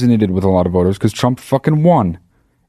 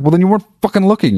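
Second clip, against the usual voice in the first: higher and fast, retold retort; snappy comeback; 10 semitones higher, much faster than usual. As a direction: fire it back quickly, mock indignant. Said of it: "And I was like".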